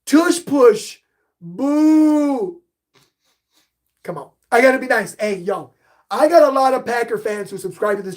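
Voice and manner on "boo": playful boo. Pretend. Booing Packer fans.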